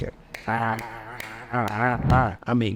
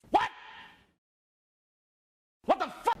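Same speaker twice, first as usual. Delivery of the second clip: shocked what; 5 dB quieter, faster than usual. Retold or imitated. Reacting to date's accusation.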